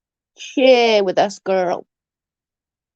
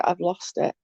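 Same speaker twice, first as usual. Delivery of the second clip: much softer, flat admission; resigned; 3 semitones lower, 7 dB quieter than usual. Real, not acted. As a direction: let it fall away, quietly defeated. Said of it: "there's no losing about it".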